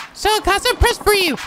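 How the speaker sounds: very high falsetto; girl character voice. Acted. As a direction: put on a squeaky little-girl falsetto.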